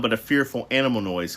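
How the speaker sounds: ordinary delivery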